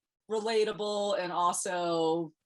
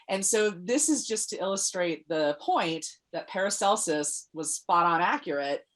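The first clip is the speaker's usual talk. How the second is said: normal talking voice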